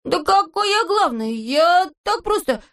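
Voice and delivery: high-pitched excuse-making character voice; high, defensive protest; flustered backing down. Acted. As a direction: disown the title in a high voice. Neznayka backs down under accusation.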